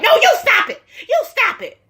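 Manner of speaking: high-pitched imitation of snapping back; mock angry retort. Recapper mimicking wife talking back to husband, high mock yell. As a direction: throw it back in a mock screech. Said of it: "She's like".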